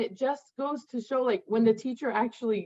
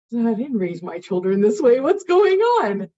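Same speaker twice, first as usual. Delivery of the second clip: exasperated mother; 7 dB louder than usual. Retold or imitated. Mother reacting to a teacher's note about her child, exasperated.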